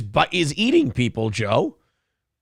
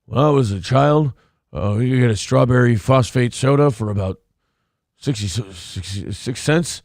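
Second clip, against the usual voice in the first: low, mock senile old-man voice; hushed, halting old-timer impression; old man whisper; 4 semitones lower than usual. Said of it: "old man whisper".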